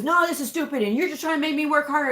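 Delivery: imitated angry rant, high and fast; mock outraged complaint, pitched well up; blustering. Coder retelling the doctor's complaint to her, mock blustering. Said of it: "he's going on".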